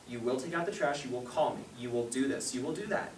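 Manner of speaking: low, quiet, stern string of orders; commanding parent. Retold or imitated. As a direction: imitate a parent issuing commands without discussion. Acting commanding parent listing orders.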